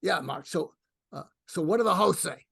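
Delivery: put-on a fed-up old New Yorker from Brooklyn, pushing him away; annoyed Brooklyn-accented mentor grumbling at being woken; Larry King's Brooklyn accent. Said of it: "in his Brooklyn accent".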